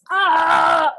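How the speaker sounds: loud, high yell of pent-up anger; re-enacted yell. Mother re-enacting her angry yell in the woods, yelling. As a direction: let out a frustrated scream, acting it out. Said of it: "like, really yelling to the point".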